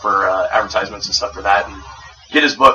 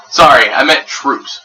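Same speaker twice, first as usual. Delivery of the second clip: ordinary delivery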